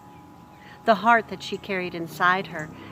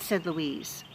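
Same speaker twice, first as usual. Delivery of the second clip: ordinary delivery, nothing marked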